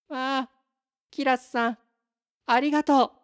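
ぐっと高い声で弾む女の子; 喜びがあふれるように、最後を強く; 大喜びのお礼. Acted. 薬をもらって喜ぶ娘. (甲高く演じた女の子の感謝)

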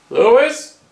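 high, rising anxious call; nervous calling out. Acted. Frightened man calling for his friend Lewis, high and anxious. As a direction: call into the dark, afraid.

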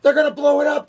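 very high, loud yell; crying out. A narrator's reading. Army chief warning the president, high loud cry. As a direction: yell it at the top of your range. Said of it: "cried the chief of the army".

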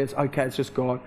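soft, low, soothing tone; gentle retold reassurance; settling him. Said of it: "I had to just settle him there".

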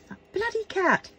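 high-pitched exasperated cry. Real, not acted. Cursing the cat that scratched her painting, exasperated. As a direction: throw up your hands at the cat.

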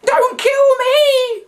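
very high, loud, slow plea; shrill beseeching plea. A narrator's reading. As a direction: beg for your life up high. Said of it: "Annie Beth beseeched him".

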